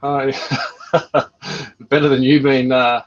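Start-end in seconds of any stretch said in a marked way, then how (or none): 0.4-1.8 hearty chuckle at the joke; laugh. Real, not acted. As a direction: chuckle along, amused. Amused by the joke.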